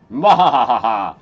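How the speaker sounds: loud bright cackle at own idea; laughing. Real, not acted. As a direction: laugh out loud, pleased with yourself.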